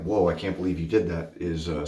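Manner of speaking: normal talking voice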